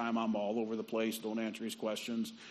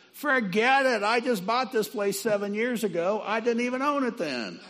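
much higher and louder, brushing it off; loud dismissive; 11 semitones higher, 8 dB louder than usual. Retold or imitated. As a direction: wave it all away loudly. Owner waves off the confession.

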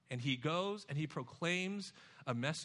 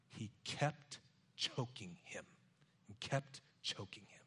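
hushed emphasis; 10 semitones lower, 7 dB quieter than usual. Real, not acted. Preacher hushing his congregation on the servant's violence, near whisper.

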